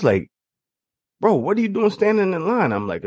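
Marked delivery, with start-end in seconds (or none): 1.2-2.7 friendly bro. Retold or imitated. Alumnus calling him over.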